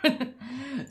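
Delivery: soft meek okay cool then a laugh; imitating her own meek reply, laughing; mock agreement with laugh. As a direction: shrug it off meekly, then laugh. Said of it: "And I was like".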